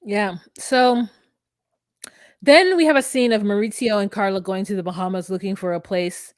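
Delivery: ordinary talk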